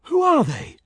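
high, loud alarmed demand; quick alarmed question. Acted. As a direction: blurt it out in alarm. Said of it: "asked Georg quickly".

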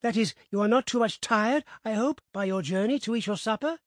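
lightly voiced eager host; eager old gentleman. A narrator's reading. Narrator voices Mister Abney.

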